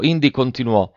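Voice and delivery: ordinary delivery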